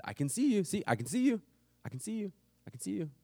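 high called-out reassurance; calling out reassurance. Retold or imitated. Dad calling out to his scared son, high and sing-song. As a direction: call it across the lot to him.

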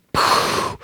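breathy sound-effect poof; whispered poof. Acted. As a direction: puff it out like a flame igniting. Fire falls from heaven.

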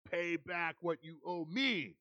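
slow, hard, threatening demand; demanding servant. A narrator's reading. Servant choking his fellow servant, slow hard demand. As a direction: squeeze the debt out of him. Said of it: "he demanded".

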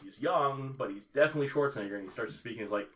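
ordinary talk

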